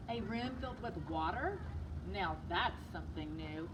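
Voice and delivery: ordinary delivery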